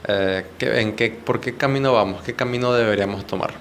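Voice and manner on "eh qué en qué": ordinary talk, nothing marked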